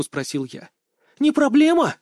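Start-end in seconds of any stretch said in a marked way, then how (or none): 1.2-2.0 very high, louder, incredulous echo; startled outburst. A narrator's reading. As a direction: jump on the words in disbelief. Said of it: "аж подпрыгнула она". (изумлённо, высоко и громко)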